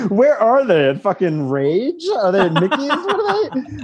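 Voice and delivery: high, laughing, incredulous questions; laughing disbelief. Real, not acted. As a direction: squeal the questions through laughter.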